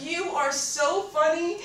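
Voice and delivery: high, loud, drawn-out hilarity; hysterical. Retold or imitated. Telling friend his joke is hilarious, hysterical. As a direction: gush it as if cracking up, slow and high.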